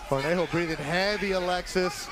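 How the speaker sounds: ordinary talk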